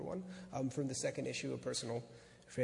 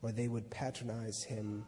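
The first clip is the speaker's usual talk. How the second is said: steady reading voice, a touch louder; story narration; 7 dB louder than usual. A narrator's reading. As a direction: read the essay aloud to an audience. Reads the essay aloud.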